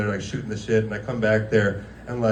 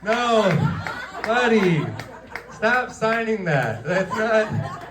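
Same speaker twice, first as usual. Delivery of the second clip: laughing; 8 semitones higher, much slower than usual. Retold or imitated. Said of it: "I'm like".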